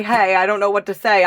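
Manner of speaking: loud, high, cheery; mock viewer email. Reader acting out a viewer's hello, loud and cheery. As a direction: play a shy viewer blurting it out. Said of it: "just say".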